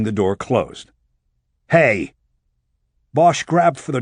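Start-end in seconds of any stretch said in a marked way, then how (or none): 1.7-2.1 shout. A narrator's reading. Locked in, shouts at Brenner.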